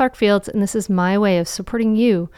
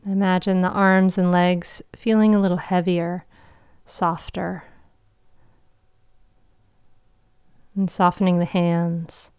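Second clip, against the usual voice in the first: guided meditation. Acted. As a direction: guide them into calm, with long pauses.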